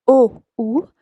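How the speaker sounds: O, U dicho como una U sola; ou como U española. Shown as a demonstration. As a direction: junta O y U en un sonido "u". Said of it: "se pronuncia igual que la letra U". (ou pronunciado "u", bien alto)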